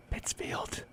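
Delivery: sudden breathy whisper on the last word; whispered ending in a Walken impression. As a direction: drop to a whisper to end the station ID. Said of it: "You gotta get the whisper in there".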